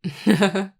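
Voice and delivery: quick amused laugh; light laugh. Acted. Laughs about sun sensitivity.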